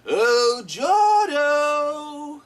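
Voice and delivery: high, loud, sing-song call; Joker calling out. Retold or imitated. Joker searches the amusement park for the kid, calling him in a high, loud croon. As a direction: croon the name like a playful villain hunting. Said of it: "There's a funny line where he's like".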